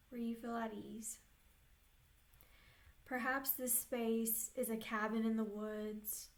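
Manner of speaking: unhurried meditation voice with long pauses; guided meditation. Real, not acted. Therapist leading clients into an inner peaceful place, guided meditation. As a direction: guide them gently, leaving space between phrases.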